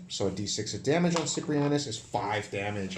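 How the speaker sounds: ordinary delivery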